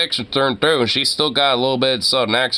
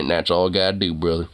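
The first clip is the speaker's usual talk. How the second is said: put-on Southern cowboy accent; 7 semitones lower, 3 dB quieter than usual. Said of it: "nice little Southern cowboy type of accent".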